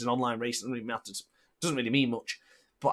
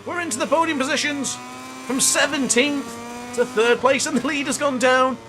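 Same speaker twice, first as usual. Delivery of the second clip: loud, much higher excited commentator yell; excited race shout; 10 semitones higher, 3 dB louder than usual. Real, not acted. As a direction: shout it like a live race caller seeing a crash. Crash ahead in the race.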